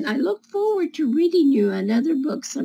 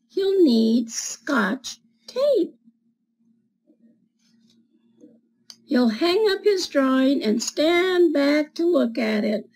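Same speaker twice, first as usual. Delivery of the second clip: put-on reading to children; slower than usual. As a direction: read it like storytime for little kids. Reads picture book to children.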